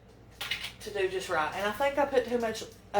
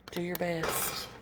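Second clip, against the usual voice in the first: ordinary delivery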